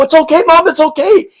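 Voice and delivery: put-on a kid trying to calm mom down; high reassuring kid. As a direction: soothe her in a high, eager voice. Kid calming panicked mom.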